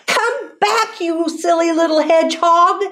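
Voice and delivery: high-pitched storybook call; light, high girl-calling voice; calling after. A narrator's reading. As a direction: call after a runaway, high without volume. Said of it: "she called".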